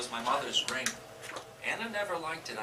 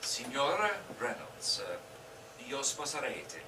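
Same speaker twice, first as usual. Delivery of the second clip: clumsy Italian; 2 semitones lower, 5 dB quieter, slower than usual. Pretend. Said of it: "Ask me in Italian".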